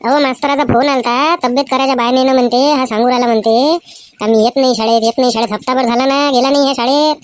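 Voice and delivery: mother complaining to her husband about the teacher's call. Acted. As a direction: tell the husband off about the boy.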